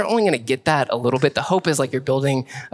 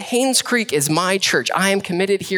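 fervent preaching; 5 semitones higher than usual. Acted. Preaching at full force.

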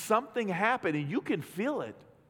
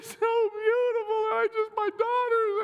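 hammed-up crying in a much higher, louder voice; mock blubbering; 4 dB louder than usual. Pastor mocking himself crying at the wedding scene, hammed-up blubbering much higher. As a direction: ham up a weepy dad losing it at a movie. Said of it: "and I started crying".

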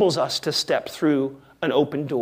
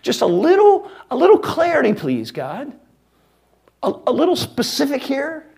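high, pleading whine to God; mock pleading; 9 semitones higher, 5 dB louder than usual. Worshipper whining to God for a little clarity, mock pleading.

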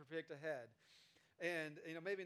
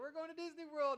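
put-on higher, bright-eyed kid voice; eager toddler; 8 semitones higher, 5 dB louder than usual. Toddler waking up for Disney, childish and excited. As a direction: announce it like a thrilled child.

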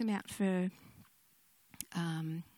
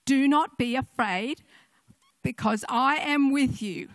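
put-on child repeating verse; 5 semitones higher, 10 dB louder than usual. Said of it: "And he said".

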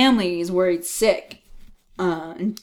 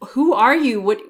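freaked out; 3 semitones higher than usual. Retold or imitated. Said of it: "he's freaking out".

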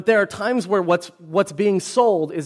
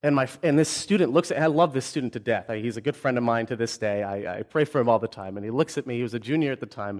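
ordinary delivery

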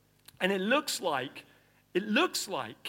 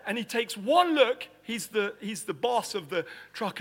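ordinary talk, nothing marked

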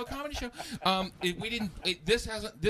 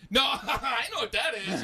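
laughing reply; 7 semitones higher, 4 dB louder, slower than usual. Retold or imitated.

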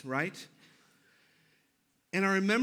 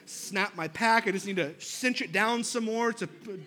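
ordinary delivery

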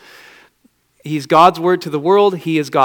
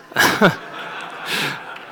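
soundless laugh. Real, not acted. Laughing at his own meme.

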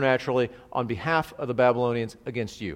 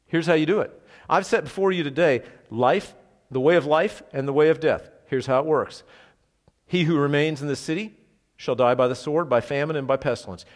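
louder, rising preacher's proclamation; forceful preaching; 3 semitones higher, 5 dB louder than usual. Acted. Preacher proclaiming God's terms to the besieged city, forceful. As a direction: drive it home like a prophet.

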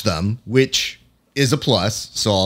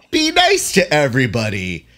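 higher, sugary mock-cheerful yay; mock sappy cheer; 6 semitones higher than usual. Critic mocking the fighter as a naive, sugary friend-to-all. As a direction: lampoon a naive everyone-is-friends attitude. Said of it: "you sitting there being like".

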